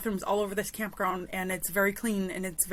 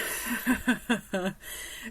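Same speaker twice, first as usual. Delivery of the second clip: amused laugh; 2 semitones higher than usual. Real, not acted. Storyteller looking back on her rant, amused chuckling. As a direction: run of chuckles.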